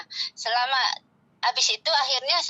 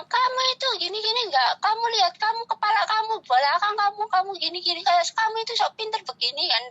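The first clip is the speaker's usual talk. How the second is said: high, sneering imitation of her scolding; high mocking scolding voice; 10 semitones higher than usual. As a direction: mimic a scornful rant, pitched up. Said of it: "Dia bilang gitu kan".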